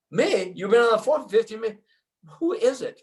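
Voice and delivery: high, loud, fast disbelief; incredulous retold outburst. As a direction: demand to know who's calling, rattled off fast. Exasperated at girlfriend on phone.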